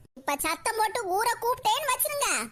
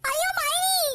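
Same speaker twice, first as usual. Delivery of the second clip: crying out; 6 semitones higher, much slower than usual. Acted. Girl cries out for help.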